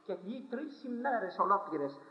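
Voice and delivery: usual speaking voice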